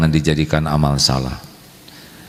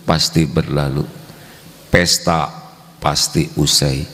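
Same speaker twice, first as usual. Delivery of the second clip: ordinary talk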